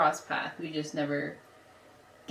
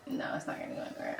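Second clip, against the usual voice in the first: whispered; 8 dB quieter, faster than usual. Retold or imitated. Retold inner thought.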